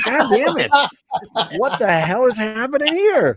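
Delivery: a bewildered yelp of disbelief; exasperated Doug. Retold or imitated. Voicing Doug shocked at naked mutants, high mock outrage.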